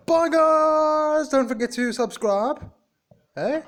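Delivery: very high, loud squealed farewell; squeaky, over-the-top outro call; excited sign-off. Pretend. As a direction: shout goodbye in a high, giddy voice.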